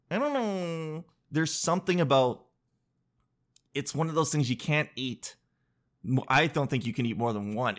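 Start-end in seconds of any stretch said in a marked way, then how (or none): none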